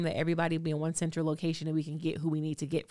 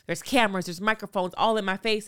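ordinary talk, nothing marked

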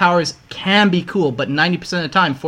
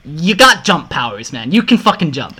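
loud, disbelieving plea; exasperated yell; 6 dB louder than usual. Pretend. Arguing with lazy hero.

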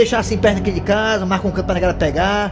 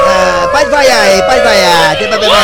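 louder, drawn-out defiant dare; loud defiant call; 5 dB louder, slower than usual. Real, not acted. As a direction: shout it out, daring the crowd to boo. Host dares listeners to boo. (desafio gritado)

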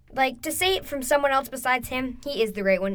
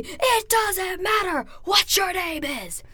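slow, much higher shouted It doesn't matter; The Rock catchphrase shout; 8 semitones higher, much slower than usual. Retold or imitated. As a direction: bellow the catchphrase slowly like to an arena. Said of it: "that's where it all came from".

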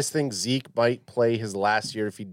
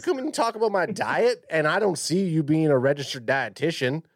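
lightly held Southern owner voice, pitched up; a touch of Jerry Jones twang; Jerry Jones accent; 4 semitones higher than usual. Pretend. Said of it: "I guess you're doing Jerry Jones".

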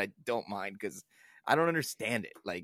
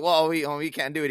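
higher, much louder mock fluster; flustered flat earther; 4 semitones higher, 6 dB louder than usual. Podcaster imitating flat earthers stumped by the math, flustered mock stammer. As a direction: stammer it out like a caught bluffer.

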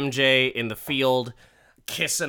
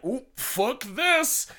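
high, slow, drawn-out mock panic; exaggerated high-pitched oops; comic alarm; 10 semitones higher, slower than usual. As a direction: squeal it as if bailing out. Retold scarecrow fleeing in alarm.

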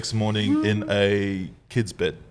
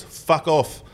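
higher-pitched, lightly exaggerated bogan Australian; bogan Aussie voice; 6 semitones higher than usual. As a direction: imitate a bogan Aussie telling someone off. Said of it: "in the most bogan Aussie voice".

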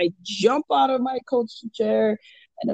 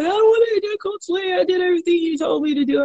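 put-on crying kid; 8 semitones higher, faster than usual. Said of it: "He was like".

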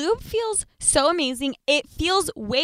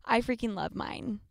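ad read. Acted. Ad read call to action.

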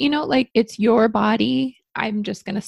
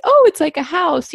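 a light hint of a delighted little girl, higher and louder; 7 semitones higher, 6 dB louder than usual. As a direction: lightly play the excited small daughter. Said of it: "the daughter was like".

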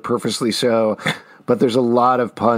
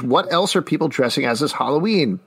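ordinary delivery

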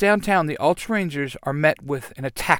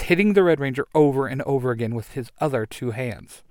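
ordinary delivery

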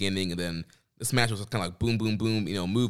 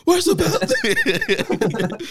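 much higher and louder, hammed-up shriek; mock shriek; 8 dB louder, much slower than usual. Co-host mimics Ospreay demanding his missing belt, shrieking. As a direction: shriek it like a cheated champ. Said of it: "he's like".

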